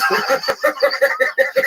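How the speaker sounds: high, loud burst of laughter; real high-pitched guffaw. Real, not acted. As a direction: crack up loudly. Laughing at the girls joke.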